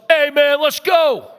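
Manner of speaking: loud, high holler from the car behind; hammed-up angry shout; impatient yell. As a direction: yell it at the driver ahead. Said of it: "he yells".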